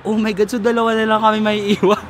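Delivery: retold excited alarm; excited panic. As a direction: gasp it out in playful dread. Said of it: "Tas sabi ko".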